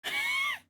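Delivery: very high shrieking laugh; squealing laugh. Real, not acted. Streamer cracking up at own accent, high squealing laugh.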